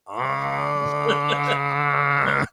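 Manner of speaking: long monotone "ah"; one droning, held mock cry; David. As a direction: drone it out steadily without a break. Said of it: "David would just go".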